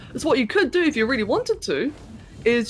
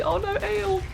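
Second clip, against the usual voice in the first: high whine; 10 semitones higher than usual. Acted. High squeaky complaint to friend.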